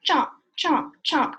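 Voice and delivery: loud chomps. Acted. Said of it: "And he says".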